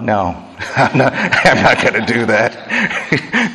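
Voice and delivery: mock Peter saying no, breaking into laughs; amused refusal. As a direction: chuckle as you turn down an order. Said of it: "just loves to tell God".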